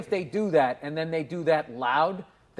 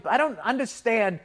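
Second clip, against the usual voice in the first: ordinary talk, nothing marked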